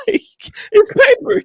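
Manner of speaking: squeaky high, slow, loud through tears of laughter; punchline quoted in high helpless laughter; laugh-crying quote. Retold or imitated. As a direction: wail it out while dying laughing. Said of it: "He was like".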